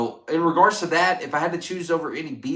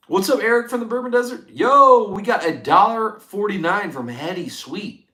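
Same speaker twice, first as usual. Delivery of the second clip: high, loud hype shout-out; excited greeting; 8 semitones higher, 5 dB louder than usual. Real, not acted. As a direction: call it out like a fired-up streamer. Greeting a donor on stream.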